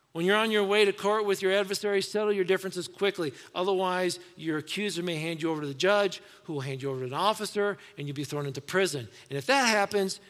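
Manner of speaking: full-voiced sermon read; preaching scripture. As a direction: drive the verses home to the congregation. Reads the passage aloud.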